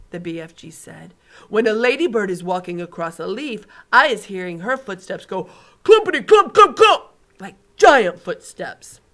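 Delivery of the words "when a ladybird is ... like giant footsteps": stomp out clumpity clump, clump, clump; booming giant, building. Acted. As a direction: giant acting out huge footsteps. BFG voice again.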